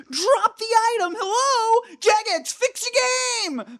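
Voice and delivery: imitating a gamer losing it at the developers; hammed-up screen rage; mock yelling at screen. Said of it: "He started yelling at the screen".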